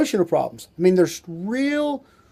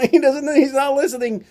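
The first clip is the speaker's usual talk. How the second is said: wife complaining; 8 semitones higher than usual. Retold or imitated. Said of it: "And the wives go".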